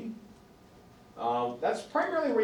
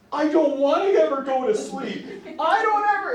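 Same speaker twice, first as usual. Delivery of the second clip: put-on high, loud pleading child voice; scared child; 11 semitones higher, 6 dB louder than usual. Child refusing to sleep after the dog died, high loud pleading. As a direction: beg like a kid afraid of sleep. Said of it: "And the child's like".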